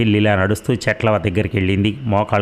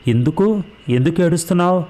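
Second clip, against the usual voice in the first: put-on high, startled little girl asking why; a stunned child asking why he cries; startled question; 9 semitones higher than usual. A narrator's reading. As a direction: ask in sudden alarm, voice jumping up. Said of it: "తెల్లబోయింది Parvati".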